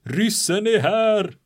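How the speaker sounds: high, loud, slow panicked cry; the guard yelling hysterically that the Russians have landed. Retold or imitated. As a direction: shriek the alarm as the terrified guard. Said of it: "skrek hysteriskt på svenska".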